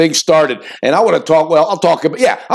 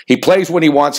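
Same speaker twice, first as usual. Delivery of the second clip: ordinary delivery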